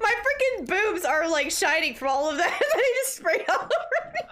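giggling through the words; high, breaking into laughter; laughing. Real, not acted. Giggling about body spray shine.

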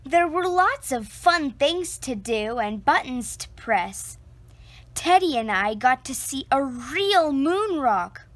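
usual speaking voice

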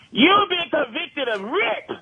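high, slow accusing announcement; mock officer reading out a charge; stern border officer. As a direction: bang the table and pronounce the charge slowly. Officer bangs table and accuses.

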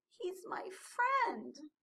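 high, slow plaintive little voice; small, pouting penguin; sniffly and hurt. A narrator's reading. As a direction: draw it out sadly. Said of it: "sniffed Flip".

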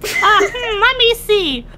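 high, slow mocking voice. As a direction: mimic her mistake mockingly. Mocking the mistake.

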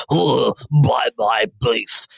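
put-on slow, thick conservative politician accent; mock CEO in a Tory politician accent. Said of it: "a very thick conservative politician accent".

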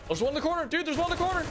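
high urgent warning; urgent callout. Real, not acted. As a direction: call out the enemy fast. Calling enemy location.

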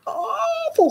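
very high squawking bird voice; squeaky cockatoo. A narrator's reading. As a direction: squeak it like a parrot. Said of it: "squeaked the cockatoo".

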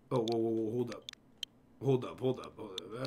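usual speaking voice

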